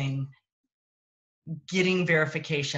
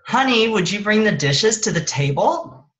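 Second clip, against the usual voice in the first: edgy request, pitched up and louder; a little annoyed; 5 semitones higher, 9 dB louder than usual. Shown as a demonstration. Husband asking for dishes, a touch edgy and louder. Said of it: "I might hear".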